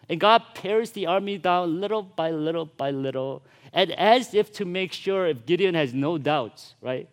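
usual speaking voice

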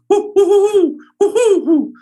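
high, loud owl hooting; male great horned owl hoots. Shown as a demonstration. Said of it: "The male is".

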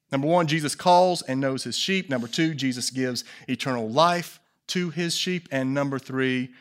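usual speaking voice

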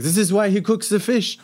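put-on high-pitched French-accented complaint. Head chef belittling cook to executive chef, French accent. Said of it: "in his French accent".

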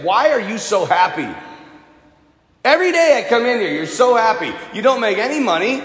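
high-pitched, incredulous questioning; mock accusing woman; challenging. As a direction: demand an explanation for all that happiness. Gym woman confronts cheerful worker.

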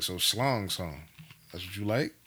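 low, deadpan sarcastic question. Acted. Man confronting wife over her phone pics, sarcastic. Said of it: "say it like that sarcastically".

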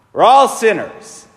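usual speaking voice

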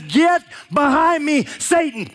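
Jesus telling Peter off sharply; stern rebuke. Retold or imitated. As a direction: come down hard on him slowly.

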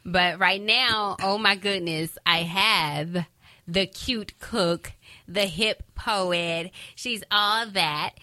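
loud, slow, drawn-out show intro; guest introduction build-up. Real, not acted. Host presenting her mother on the show, announcer build-up. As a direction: stretch out each title like a proud announcer.

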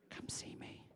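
whispered, sly come-hither; beckoning best man. Acted. Best man beckoning the bride away, whispered and sly. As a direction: lure her in with a whisper. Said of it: "And he's going, winking, kissing, beckoning".

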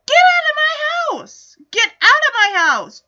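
put-on high, loud furious shout at the boy; angry yelling dad. Father yelling at the boy who insulted his daughter, furious shout. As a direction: bellow him out of the house.

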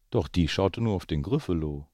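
put-on lively read-aloud voice for children; story narration. A narrator's reading. Parent reading The Gruffalo to the children, lively storytelling. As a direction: tell it like a bedtime story. Reads picture book aloud.

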